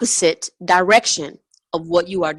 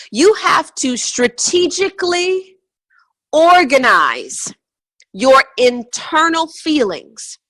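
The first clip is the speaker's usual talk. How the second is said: high, slow words pressed out one by one; slow emphatic preaching; 4 semitones higher, slower than usual. Shown as a demonstration. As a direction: drive each word home slowly. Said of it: "stressing internal order".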